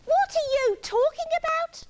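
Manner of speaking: high, cross yell; Iggy yelling up. A narrator's reading. Iggy left out of the chat, cross yell. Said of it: "yelled Iggy from the bottom".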